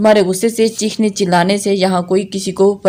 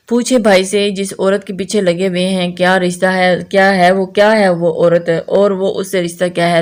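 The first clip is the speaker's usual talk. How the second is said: usual speaking voice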